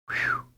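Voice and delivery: high whistling whew; whistled whew. A narrator's reading. Boy whistling on reaching the street, high whistled whew. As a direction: whistle it out in relief. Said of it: "whistled Karl".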